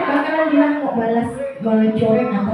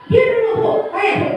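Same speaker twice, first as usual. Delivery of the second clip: put-on child raising its voice in reply; raised child voice; 9 semitones higher than usual. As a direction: shout the blame at the teacher. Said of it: "tinggi suara kita, tinggi lagi suara dia".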